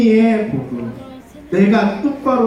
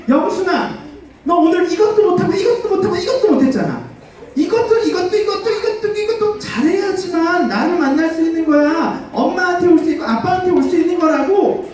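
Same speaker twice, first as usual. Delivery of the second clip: much higher nagging parent voice listing every failure; demanding parent; 8 semitones higher than usual. Acted. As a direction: hold Youngsoon to a checklist before she may come close. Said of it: "어떤 부모님이 그래". (영순이를 다그치는 부모, 높은 잔소리 목소리)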